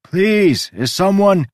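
loud, high pleading shout; desperate shouting. A narrator's reading. Hands over ears against the music, shouting. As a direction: shout a plea, breaking off. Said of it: "I shouted".

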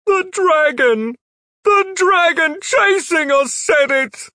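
very high, loud, slow panic; frantic answer. A narrator's reading. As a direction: blurt it out terrified. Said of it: "Heelkick answered".

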